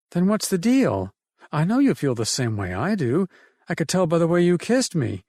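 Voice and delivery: lightly voiced woman recalling her dad; Emily's voice. Emily telling Ted about her father's guns, lightly voiced woman.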